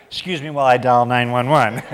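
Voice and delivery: mock alarm. Joking reaction to creepy stranger.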